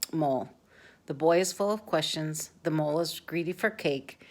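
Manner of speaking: usual speaking voice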